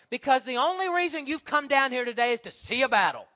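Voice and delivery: loud, scornful rebuke; angry older brother. Acted. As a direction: scold him like an angry brother. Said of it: "his anger was kindled against David".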